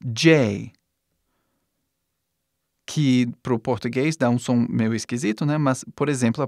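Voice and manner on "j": name the letter J the English way. Shown as a demonstration. Teacher naming letter J in English. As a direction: say J as English jay. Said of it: "Próximo".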